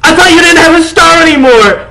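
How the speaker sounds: high, loud worried shout; alarmed shout. Acted. Girl shouting at the screaming vampire, alarmed. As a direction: shout it in disbelief. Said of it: "I shouted".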